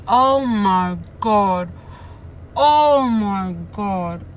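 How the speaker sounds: slow, loud stunned gasp. Real, not acted. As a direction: let the shock land slowly. Shocked reaction to plot.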